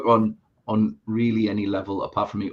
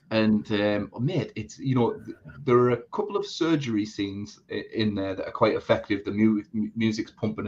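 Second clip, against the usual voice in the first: normal talking voice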